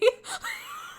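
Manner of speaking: very high squeal of laughter. Real, not acted. Reacting to the reveal, high squeal.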